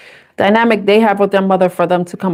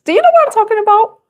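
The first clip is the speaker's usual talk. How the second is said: fast, much higher knowing question; teasing insinuation; 12 semitones higher, faster than usual. Real, not acted. Reader hinting at a rude joke to viewers, high and teasing. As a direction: wink at the viewers with a quick, high tease.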